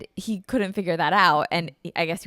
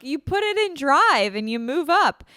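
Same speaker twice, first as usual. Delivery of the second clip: impatient explaining; 7 semitones higher, 5 dB louder than usual. Retold or imitated. Explaining to dad.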